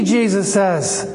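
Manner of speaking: ordinary talk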